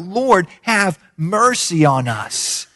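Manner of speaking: slow, drawn-out plea; imitated crying out. Blind men calling to Jesus for mercy, slow drawn-out plea. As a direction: stretch each word into a long call. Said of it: "they cried out".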